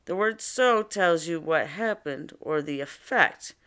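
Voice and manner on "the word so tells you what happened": normal talking voice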